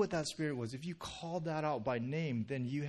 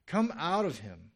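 louder, higher firm order; stern command; 4 semitones higher, 7 dB louder than usual. A narrator's reading. Jesus ordering the demon out of the man, stern. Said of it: "Jesus said sternly".

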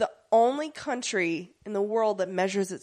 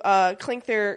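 ordinary talk, nothing marked

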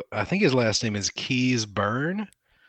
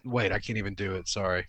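ordinary talk, nothing marked

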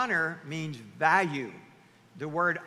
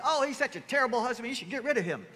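mock outrage; 6 semitones higher, 4 dB louder, faster than usual. Mimics ladies' group outrage.

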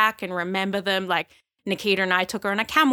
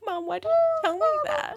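high, laughing through tears; half-crying as she asks her mum; retelling her plea to Mum, high and laughing through tears; teary retold plea. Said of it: "Oh, and I just was like".

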